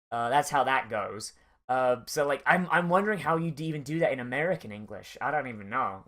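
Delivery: ordinary talk, nothing marked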